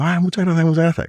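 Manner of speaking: high, delighted gratitude; eager thanks. Retold or imitated. Young lawyer thanking the judge, high and eager. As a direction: gush your thanks.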